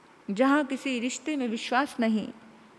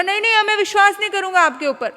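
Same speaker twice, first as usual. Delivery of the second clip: angry refusal; 8 semitones higher, 8 dB louder, faster than usual. Acted.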